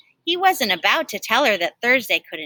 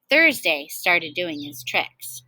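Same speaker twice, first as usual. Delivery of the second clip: plain reading voice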